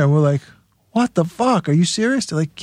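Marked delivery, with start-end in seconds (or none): 0.9-2.2 high shocked question; can't believe what you hear; incredulous. Retold or imitated. Band asking the locals if they're serious, incredulous. Retold disbelief.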